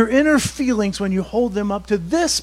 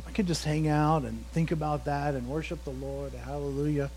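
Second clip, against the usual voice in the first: ordinary delivery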